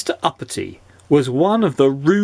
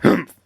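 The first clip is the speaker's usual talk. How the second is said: haughty humph, higher and louder; rude sniff; 6 semitones higher, 3 dB louder than usual. A narrator's reading. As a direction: sniff dismissively. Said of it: "sniffed Mister Uppity rudely".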